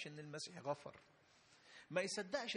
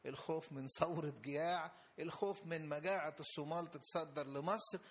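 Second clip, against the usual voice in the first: usual speaking voice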